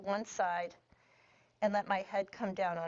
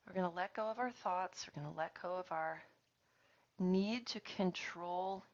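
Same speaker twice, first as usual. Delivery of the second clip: guided meditation; 2 semitones lower, 9 dB quieter than usual. Acted. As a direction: ease the class into letting go. Leads a guided relaxation.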